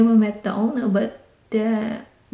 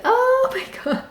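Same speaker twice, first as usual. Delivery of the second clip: high shocked squeal; high-pitched panicked oh my God; alarmed cry. Retold or imitated. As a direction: shriek it in sudden alarm. Panicked scream when dog escapes.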